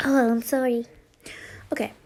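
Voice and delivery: ordinary delivery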